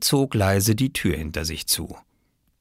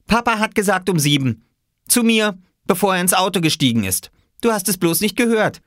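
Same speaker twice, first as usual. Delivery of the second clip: bossy big sister; 12 semitones higher, 4 dB louder than usual. A narrator's reading. Jule lies, bossy reply.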